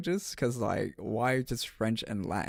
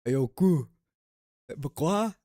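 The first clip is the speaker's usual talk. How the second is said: put-on louder, higher French-accented slang; imitated French speech; 5 semitones higher, 5 dB louder, slower than usual. Recalling French talk with teammates, raised French-accented voice. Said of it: "I'd be like, uh".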